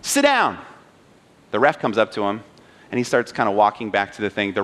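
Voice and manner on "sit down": high mock yell at moderate volume; raised-pitch, insistent command; retold coach's yell. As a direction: imitate a coach firmly ordering a player down. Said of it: "the coach yells at him again".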